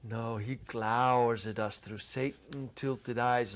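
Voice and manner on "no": plain reading voice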